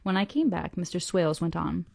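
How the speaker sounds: ordinary delivery, nothing marked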